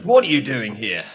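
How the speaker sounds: loud, sharp challenge from a guard; retelling a security guard's loud challenge at the gates. Retold or imitated. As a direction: call out like a guard catching an intruder.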